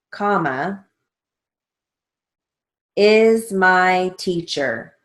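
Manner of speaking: slow, deliberate dictation read with pauses. Shown as a demonstration. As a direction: dictate each word slowly and clearly for the class. Dictation with spelled name.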